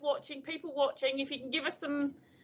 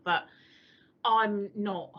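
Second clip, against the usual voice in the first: ordinary talk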